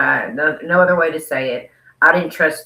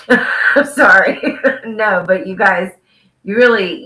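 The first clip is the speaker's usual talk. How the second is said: laughing; 5 semitones higher, 7 dB louder than usual. Real, not acted. Cracking up at own joke.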